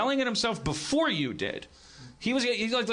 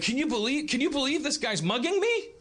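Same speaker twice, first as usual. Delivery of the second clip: mock disbelief; 10 semitones higher than usual. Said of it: "if you're going like this".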